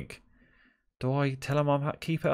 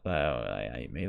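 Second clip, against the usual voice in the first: ordinary talk, nothing marked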